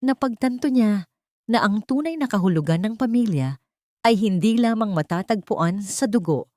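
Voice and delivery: usual speaking voice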